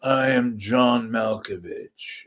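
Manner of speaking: slow, drawn-out introduction; deliberate, put-on impression; John Malkovich. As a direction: stretch each word in a mannered actor's voice. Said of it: "or is it John".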